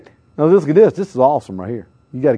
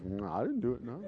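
meek denial; 5 semitones lower, 22 dB quieter than usual. Retold or imitated. Kids denying to teachers.

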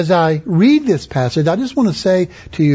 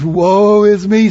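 mock woeful lament; 3 semitones higher, 4 dB louder, slower than usual. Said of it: "'Cause that means".

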